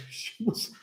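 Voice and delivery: ordinary talk, nothing marked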